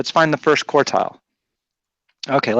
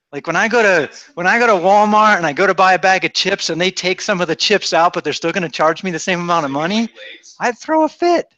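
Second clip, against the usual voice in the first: teasing, higher-pitched protest; mock indignation; 5 semitones higher than usual. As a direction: pretend to be outraged, just teasing. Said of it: "I'm just teasing you".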